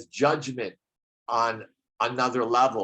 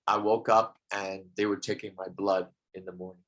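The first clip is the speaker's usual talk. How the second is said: normal talking voice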